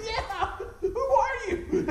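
high, loud, laughing mock alarm; drawn-out, high-pitched imitation of bewilderment; startled teacher. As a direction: squeal it in comic shock. Startled teacher voiced amid laughter.

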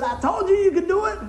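high, rising cheer; acted triumphant shout; excited encouragement. Cheering on someone who made it across, excited encouragement.

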